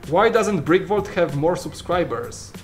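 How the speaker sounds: usual speaking voice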